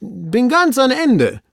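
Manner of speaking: high, slow, drawn-out report; servant's falling, called-out statement; servant calling down. Acted. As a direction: announce it from far out on the branch. Servant reports from branch end.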